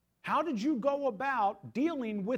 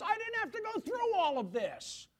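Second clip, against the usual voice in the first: whiny Jonah; 11 semitones higher, 4 dB louder than usual. Retold or imitated. Paraphrasing Jonah's complaint.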